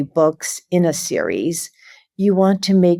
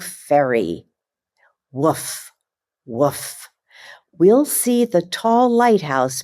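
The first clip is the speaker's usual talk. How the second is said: lively, slow picture-book reading with puppy noises; reading to children; 3 semitones higher, slower than usual. Acted. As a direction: read it like bedtime story time for little kids.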